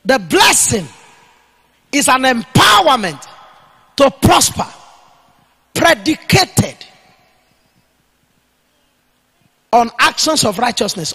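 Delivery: loud dictation in short phrases with long pauses; dictating for note-takers. Real, not acted. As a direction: give it piece by piece so they can write it down. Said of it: "Write it down".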